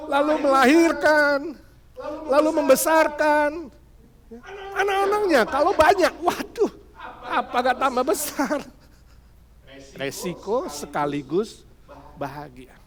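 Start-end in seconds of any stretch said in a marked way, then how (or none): 0.1-8.6 playful build. Real, not acted. Comic build on motherhood.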